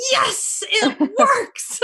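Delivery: high, laughing yes; gleeful laugh of triumph. Real, not acted. Delighted her book works.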